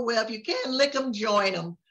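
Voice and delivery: ordinary delivery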